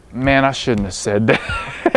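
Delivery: words breaking into a laugh; rueful line cracking up at the end; laughing regret. Retold or imitated. Coach recalling the big man standing up, laughing.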